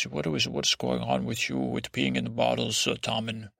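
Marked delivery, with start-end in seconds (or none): none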